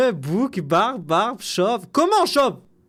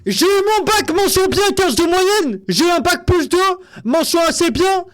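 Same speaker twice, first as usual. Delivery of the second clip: louder and higher, listing his diplomas; louder mock-indignant list of diplomas; loud indignant bragging; 6 semitones higher, 5 dB louder than usual. Real, not acted. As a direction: shout out your grades, stung and defiant. Defending his grades against jab.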